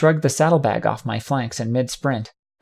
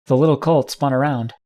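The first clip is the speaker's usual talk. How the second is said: ordinary delivery, nothing marked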